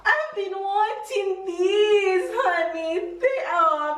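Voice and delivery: high, drawn-out delight; real delighted excitement. Real, not acted. Wife seeing the gift she wanted, thrilled and high.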